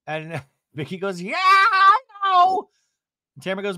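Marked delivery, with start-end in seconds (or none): 1.2-2.7 very high, loud, stretched screech; over-the-top imitated wail; mock wailing. Vicky agreeing with her eulogy, mock screeching wail.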